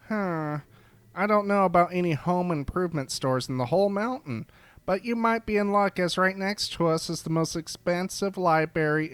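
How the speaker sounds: a chirpy creature who finds the question silly; cheerful cat creature. Acted. Cat-eared barista points Mike to the library, high and chirpy.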